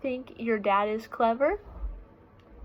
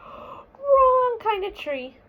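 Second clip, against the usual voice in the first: high, loud gasping little bear cry; gasping alarmed cry; 10 semitones higher, 6 dB louder, much slower than usual. Acted. Small bear gasps at the skunk-filled tree, high alarmed cry. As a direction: gasp then wail it out slowly like a startled cub.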